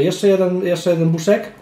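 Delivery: talking around hookah pipe; speaking with mouthpiece in mouth. Real, not acted. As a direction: lips closed on mouthpiece. Seen 1: Mouthpiece held in lips while speaking, then he inhales and exhales smoke.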